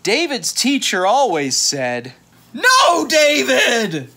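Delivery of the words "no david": high, loud scolding cry; teacher's shout. Acted. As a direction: yell it like a fed-up teacher. Teacher scolding in read book.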